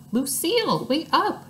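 shouted. A narrator's reading. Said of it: "he shouted".

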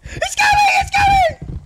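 very high, loud squealed warning; hammed-up squealing panic; panicked shriek. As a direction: scream it far above normal pitch. Panic as threat approaches.

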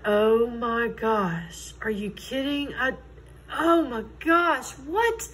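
retold shock, slow gasped words climbing in pitch; shocked disbelief. Teller recalls hearing the witchcraft rumor, shocked disbelief.

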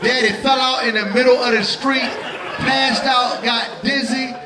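high, laughing near-shout. Real, not acted. Excited laughing retelling.

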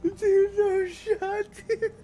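very high, squeaky incredulity; laughing disbelief. Real, not acted. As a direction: say it through helpless laughter. Laughing disbelief after chase.

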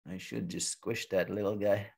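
low, soft, contained annoyance; steamed-up bear. Acted. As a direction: grumble a threat to yourself. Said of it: "getting all steamed up".

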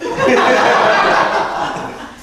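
high breathy laugh. Real, not acted. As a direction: burst out laughing at the punchline. Narrator laughs at end.

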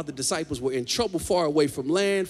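usual speaking voice

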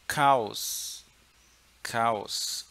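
"Caos" with a hard k, as "kaos". Shown as a demonstration. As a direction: hit a clean k at the start of "Caos". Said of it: "vai falar com som de K". ("Caos" começando com som de K)

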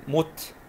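مت cut off on the t; closed syllable. Shown as a demonstration. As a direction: say مت alone as "mut". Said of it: "مقطع ساكن".

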